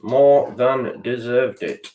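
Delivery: slow emphatic repeat. Shown as a demonstration. Fan conceding the rival earned the win, slow and heavy. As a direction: let it sink in slowly. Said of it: "oh, more than deserved it".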